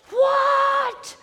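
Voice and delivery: high, loud, drawn-out shock; hammed-up crowd gasping a word; shocked outcry. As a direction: cry out in mock disbelief.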